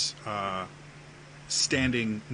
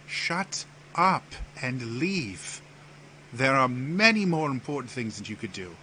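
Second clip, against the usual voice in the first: imperious prince; 4 semitones higher than usual. Acted. Prince orders Theus to leave, imperious.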